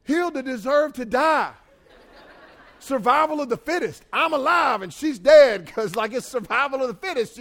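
loud, high comic outburst; mock-outraged shouting; loud defiant retort. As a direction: defend yourself at full voice, high and indignant. Acted self-defence to his wife.

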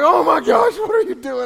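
high, loud, laughing shriek of shock; shocked wife. Retold or imitated. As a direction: imitate his wife's shocked laughing shriek. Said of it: "she was like".

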